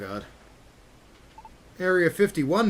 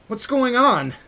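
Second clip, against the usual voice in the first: alarmed; 8 semitones higher, 6 dB louder, slower than usual. Acted. Shocked by his reflection.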